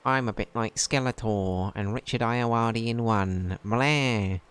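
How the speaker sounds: high, slow villain voice, like Skeletor crossed with Richard Ayoade; a lightly hammed-up skeletal creep introducing himself; trying out a voice. Player trying out the union boss voice, a high, slow creep. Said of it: "How would he sound?".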